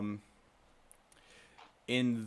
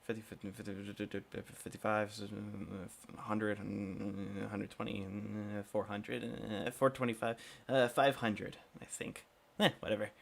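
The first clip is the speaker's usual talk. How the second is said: quiet muttered counting; counting under breath; 4 semitones lower, 8 dB quieter than usual. Acted. Shopkeeper counting coins in his head, quiet and low. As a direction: mumble the sum to yourself. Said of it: "in his head, kinda go".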